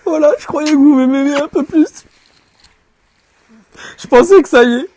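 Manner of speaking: high, loud mock whine; mock hurt whine.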